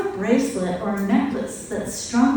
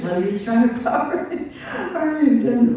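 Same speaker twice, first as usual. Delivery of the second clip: words broken by a light laugh; 2 semitones higher than usual. Real, not acted. Teacher laughing to the class about badly strung malas. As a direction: chuckle through it.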